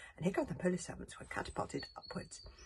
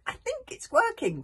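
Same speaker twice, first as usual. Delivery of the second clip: panting relief; 9 dB louder than usual. Acted. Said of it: "panted Hiccup".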